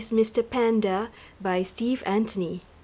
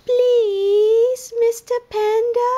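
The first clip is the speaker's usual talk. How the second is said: much higher, louder, sweet little pleading voice; tiny lemur voice; 13 semitones higher, 6 dB louder, slower than usual. A narrator's reading. Lemur politely asks panda for a donut, very high. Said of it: "which is the magic word".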